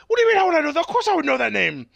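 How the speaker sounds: high, loud cartoon indignation; mock indignant retort. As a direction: imitate an affronted character firing back. Acted indignant reply between characters.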